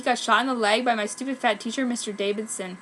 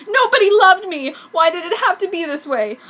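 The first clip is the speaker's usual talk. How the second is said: higher, louder hammed-up wail; melodramatic despair; 8 semitones higher, 9 dB louder than usual. Goth girl alone in the bathroom, hammed-up despair. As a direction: wail it like a tragic teen.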